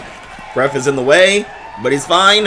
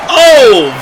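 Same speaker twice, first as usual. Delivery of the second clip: excited; 9 semitones higher, 9 dB louder than usual. Real, not acted. Wrestling move lands.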